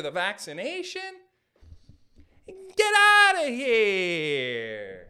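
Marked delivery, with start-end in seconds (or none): 2.5-5.0 long stretched mock outrage, loud; drawn-out disbelief. Comedian scoffing at vaccine excuses, drawn-out loud disbelief. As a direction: drag out a loud "get outta here".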